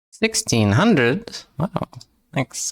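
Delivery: ordinary talk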